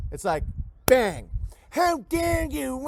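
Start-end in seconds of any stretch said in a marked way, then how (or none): 1.7-2.9 very high, slow, drawn-out squeal; mock outrage. Streamer mocking a chatter's outrage at being banned, high and slow. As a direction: stretch the outrage out like a pompous snob.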